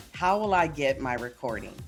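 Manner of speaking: ordinary talk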